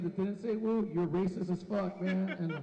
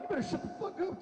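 mock threat; 10 semitones higher than usual. Threatens the screaming kids in act.

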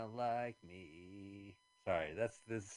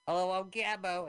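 puppet voice; 8 semitones higher, 7 dB louder than usual. Retold or imitated. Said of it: "Gabo came and took over".

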